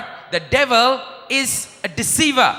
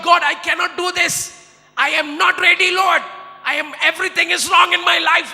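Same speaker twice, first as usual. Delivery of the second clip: desperate cry to God; 7 semitones higher than usual. Retold or imitated. Preacher retells crying to God before service, pleading. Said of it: "And I say to God".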